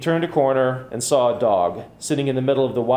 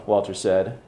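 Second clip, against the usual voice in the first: ordinary delivery, nothing marked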